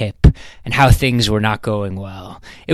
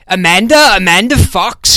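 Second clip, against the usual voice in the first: raised, higher call through a door; shouting a name; 8 semitones higher, 6 dB louder than usual. A narrator's reading. British visitor shouting at her door. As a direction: call out loud and high for Amanda. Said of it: "shouted a British man".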